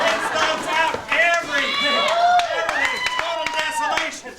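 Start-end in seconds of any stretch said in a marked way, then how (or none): 0.1-2.0 loud. Acted. Building monster rant. 2.6-3.0 shrieked high. Acted. Shrieked repeat of everything.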